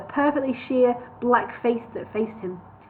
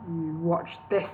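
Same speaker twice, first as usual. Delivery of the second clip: lower, softer aside; muttered to nobody; 5 semitones lower, 7 dB quieter, slower than usual. A narrator's reading. Gandalf murmuring to nobody before the spell. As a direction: mutter it to yourself. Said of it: "he told nobody in particular".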